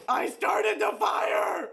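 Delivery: high, stretched-out yell; mock-panicked shout. Crew member confesses to the ship AI, hammed-up shout. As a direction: wail it slowly at a high pitch.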